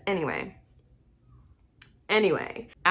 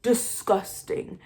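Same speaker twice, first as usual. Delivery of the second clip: slow, drawn-out disgust, slightly raised pitch; 4 semitones higher, slower than usual. Real, not acted. Viewer judging a cheating husband, drawn-out disgust. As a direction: stretch the word out with disgust.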